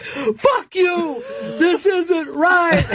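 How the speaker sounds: high, wailing mock yell, drawn out; retold yelling back. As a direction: imitate an outraged fan wailing back over his shoulder. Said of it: "he starts to yell back".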